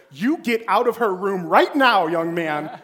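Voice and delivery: loud stern mom yelling; angry mother, loud; mother scolding. Retold or imitated. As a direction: order the boy out of the room. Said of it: "my mother comes in, and she goes".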